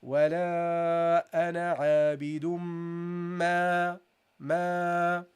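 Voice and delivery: ولا أنا عابد ما slow, meme held nasal; recited with ghunna. Shown as a demonstration. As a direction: chant it, prolonging the fatha. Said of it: "So you say".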